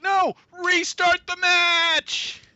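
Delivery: high, drawn-out mock referee shout; hammed-up loud command; shouted order. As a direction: bellow the order slowly, stretching the last word.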